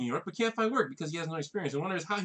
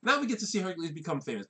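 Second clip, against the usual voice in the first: ordinary delivery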